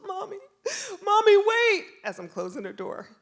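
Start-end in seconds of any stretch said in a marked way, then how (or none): 0.1-1.8 high mock-tearful call, building to loud; crying child. Son calls as mom closes door, acted wail. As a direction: call for mom like a panicking kid. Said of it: "he's crying. He's melting down".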